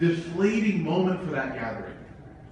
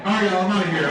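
laughing; 2 semitones higher, 7 dB louder, much faster than usual. Retold or imitated. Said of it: "I was like".

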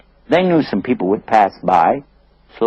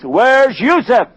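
drawn-out, pitched-up "Where's Yusuf"; slow down and insist; insistent slow question; slow insistent demand; 12 semitones higher, much slower than usual. Acted. Father insists, slowly.

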